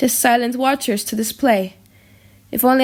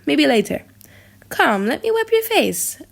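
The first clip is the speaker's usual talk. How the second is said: usual speaking voice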